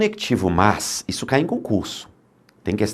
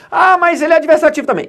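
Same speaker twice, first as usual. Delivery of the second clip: high-pitched mock student protest, louder; objecting student; 4 dB louder than usual. Student protests to the teacher that it is also adversative, in a high mock voice. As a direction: squeak out the objection like a puzzled pupil.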